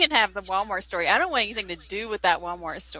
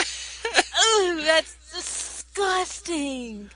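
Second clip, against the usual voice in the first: grossed-out groan, drawn out; slow disgusted moan; 6 semitones higher, much slower than usual. Real, not acted. Disgusted cry, slowed.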